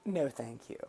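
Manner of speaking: soft whispered polite refusal; hushed Canadian politeness; whispered politely. Retold or imitated. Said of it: "a Canadian would probably just tell them".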